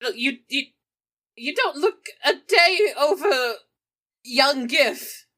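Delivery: ordinary talk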